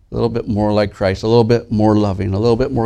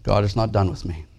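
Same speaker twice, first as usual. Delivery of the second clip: quiet restatement; 6 dB quieter than usual. Shown as a demonstration. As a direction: let it settle, quietly. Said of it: "repeats the phrase".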